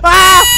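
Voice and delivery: loud, high yell; sudden play-scared shout; startled scream. Pretend. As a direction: scream in mock fright. Startled by pretend monster.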